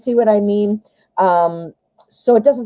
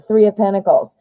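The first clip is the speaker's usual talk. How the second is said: ordinary delivery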